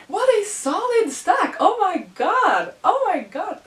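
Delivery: high, loud giddy squeals; freaking out. Real, not acted. Opening a friend's gift, she freaks out with joy. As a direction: gush over the whole stack in disbelief. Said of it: "I'm freaking out".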